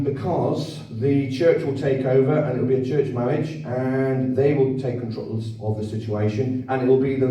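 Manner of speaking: normal talking voice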